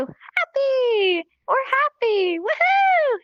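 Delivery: high, slow, drawn-out happy cheer. Shown as a demonstration. Teacher showing newcomers happy, gleeful. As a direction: celebrate the word with a smile. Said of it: "you're gonna be smiling".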